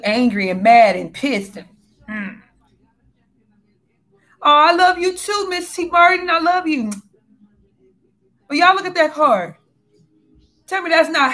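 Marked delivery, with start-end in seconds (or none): 4.4-6.9 high, bright-voiced thank you; delighted thanks. Real, not acted. Reader thanks a viewer for her kind comment, high and delighted. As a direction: gush your thanks upward.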